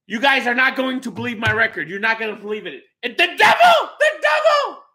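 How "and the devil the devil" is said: sudden high shout. Real, not acted. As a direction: yell it out in disbelief. Shouting at his record reveal.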